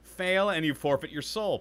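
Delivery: dramatic reading. Acted. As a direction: read it like a proclamation. Reads in-game lore aloud dramatically.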